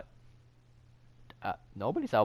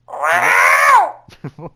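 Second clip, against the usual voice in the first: loud shriek laugh; 8 semitones higher, 17 dB louder than usual. Real, not acted.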